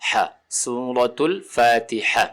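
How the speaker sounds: slow الفاتحہ with a throaty ح; correct haa. Shown as a demonstration. Teacher pointing out the haa in the surah name, careful tajweed. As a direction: draw out each syllable and land on a clear pharyngeal haa in الفاتحہ. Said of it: "یہ ہا ہے".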